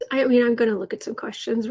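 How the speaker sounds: normal talking voice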